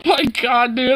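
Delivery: usual speaking voice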